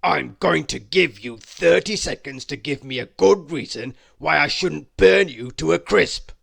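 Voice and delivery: loud dragon bellow; menacing roaring dragon voice. Acted. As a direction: threaten the tiny intruder, roaring. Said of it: "bellowed the dragon".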